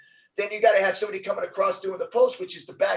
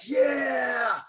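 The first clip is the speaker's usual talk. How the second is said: high, triumphant shout of yeah; pumped-up yell over a downed receiver; aggressive yell; 8 semitones higher than usual. Retold or imitated. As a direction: roar it out after the big hit. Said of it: "I'm all aggressive".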